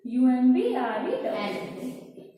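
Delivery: letter by letter, slowly spaced; spelling slowly. Shown as a demonstration. Teacher spelling umbrella for students, slow and deliberate. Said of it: "spelling out umbrella".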